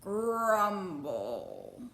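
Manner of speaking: loud mock tummy rumble; hungry belly noise; belly grumble. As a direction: growl out a hungry stomach. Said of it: "Papa Bear laughed and patted his own belly".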